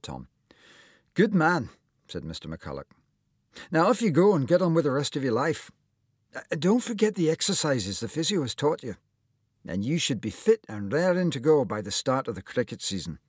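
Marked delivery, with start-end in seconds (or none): 1.1-1.7 put-on higher, louder, hearty old surgeon voice; lightly voiced cheery doctor; kindly old surgeon. A narrator's reading. Surgeon praises Tom. 3.7-13.2 lightly voiced cheery doctor; kindly old surgeon. A narrator's reading. Surgeon sends Tom off.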